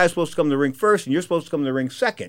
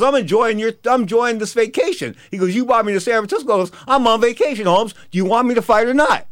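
smug, raised taunting voice; cocky taunting; 7 semitones higher than usual. Retold or imitated. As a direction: lord it over him, smug. Fighter taunts promoter over vacation.

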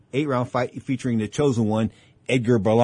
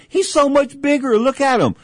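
alarmed; 12 semitones higher, 4 dB louder than usual. Retold or imitated. Retold argument with corner man.

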